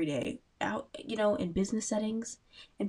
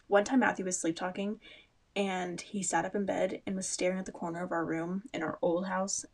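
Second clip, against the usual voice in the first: usual speaking voice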